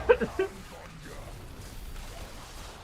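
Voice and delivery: high silent wheeze; wheezing laugh. Real, not acted. Streamer laughing at chat, wheezing laugh. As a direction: laugh without voice.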